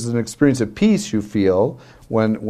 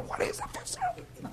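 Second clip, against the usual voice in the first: whispered; 19 dB quieter than usual. Retold or imitated. What he couldn't say aloud.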